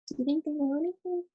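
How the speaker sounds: ordinary delivery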